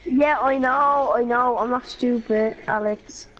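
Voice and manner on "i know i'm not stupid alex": usual speaking voice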